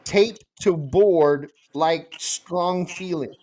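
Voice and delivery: slow, solemn pidgin instruction; slow dramatic reading. Shown as a demonstration. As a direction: intone it like ancient wisdom.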